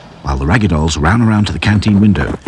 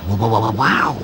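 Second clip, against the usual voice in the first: stammering; 2 semitones higher, 3 dB quieter than usual. A narrator's reading. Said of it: "stammered Hi-Fi".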